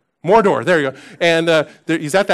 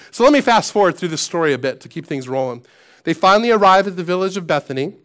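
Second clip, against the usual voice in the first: ordinary delivery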